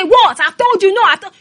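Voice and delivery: loud, high angry "What?"; hammed-up shouting husband. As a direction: play the husband snapping back angrily. Husband voiced shouting at wife.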